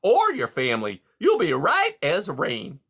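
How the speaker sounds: high, loud oily reassurance; smooth reassuring beaver. A narrator's reading. Beaver reassuring worried Rye, high and oily. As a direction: soothe him like a con man.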